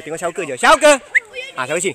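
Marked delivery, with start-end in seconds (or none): 0.6-1.0 high, loud call to a bird. Real, not acted. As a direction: shout the name up to the sky. Calling the bird back.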